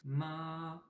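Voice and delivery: high level Ma held steady; first tone ma. Shown as a demonstration. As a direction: hold Ma high and flat like a sung note. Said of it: "on dirait un peu le la de la note musicale". (ma au premier ton, haut et tenu)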